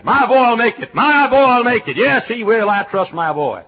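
high, loud, proudly insistent mother. Retold or imitated. Mother bragging to the preacher about her son, high and loud. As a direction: insist your son will make it.

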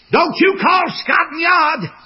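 high loud slow shout of command. Retold or imitated. King warning the man at the castle gate, shouted order. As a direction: bark the order at the gatekeeper.

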